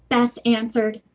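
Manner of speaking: ordinary delivery, nothing marked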